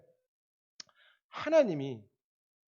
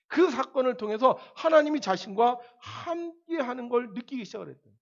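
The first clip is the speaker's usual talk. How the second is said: rising preaching; 6 semitones higher, 5 dB louder than usual. Acted. Preaching climax.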